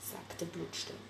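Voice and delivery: plain reading voice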